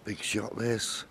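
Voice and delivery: hushed tense commentary; quiet. Real, not acted. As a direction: hold the breath before the shot. Quiet tension before key shot.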